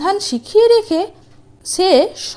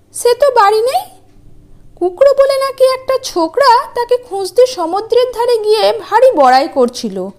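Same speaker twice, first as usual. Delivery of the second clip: scheming wife; 8 semitones higher than usual. Acted. Wife fooling giant, mock warning.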